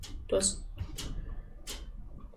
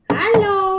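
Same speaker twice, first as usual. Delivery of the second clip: scared call; 9 semitones higher, 4 dB louder than usual. Acted. Calling into the dark house.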